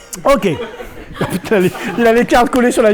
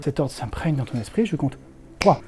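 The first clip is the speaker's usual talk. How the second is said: hypnotist voice; 2 semitones lower, 4 dB quieter than usual. Acted. Hypnotist puts subject under.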